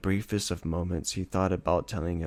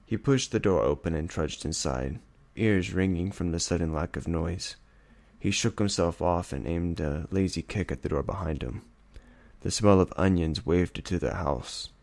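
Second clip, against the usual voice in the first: plain reading voice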